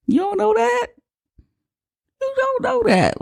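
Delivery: very high, squeaky mock disbelief; incredulous squeal. Scoffing at spirit going into baby, squeaky incredulous. Mocking the cult lady's spirit claim.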